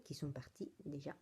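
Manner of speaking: whispered aside. Real, not acted. Telling viewers the gifts are gone, whispered. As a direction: slip it in under your breath. Aside about gifted projects.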